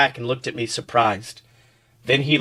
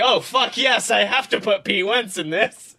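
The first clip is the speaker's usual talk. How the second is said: excited; 7 semitones higher than usual. Retold or imitated. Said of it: "And I was like".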